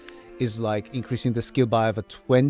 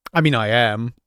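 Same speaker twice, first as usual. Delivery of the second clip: ordinary delivery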